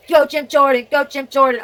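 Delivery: loud, high cheer; root for him like at a rally. Real, not acted. Cheering on a politician.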